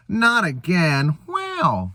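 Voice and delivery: slow then high drawn-out wow; Owen Wilson impression. Spy finds the pilot dead, Owen Wilson wow. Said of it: "That's my Owen Wilson".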